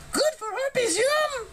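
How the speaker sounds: very high, delighted bird voice; high harpy yum; happy harpy. A narrator's reading. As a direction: chirp it like a pleased harpy. Ella tastes cinnamon.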